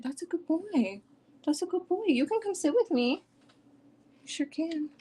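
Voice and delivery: high sing-song dog praise; pet voice. Real, not acted.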